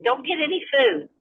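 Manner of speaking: a mother catching her daughter in the kitchen, scolding; mom scolding a child. Retold or imitated. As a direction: call out to a kid sneaking food. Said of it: "I'm like".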